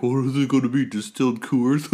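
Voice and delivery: mock the doubters, then cackle at the joke; mocking crowd. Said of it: "people were like".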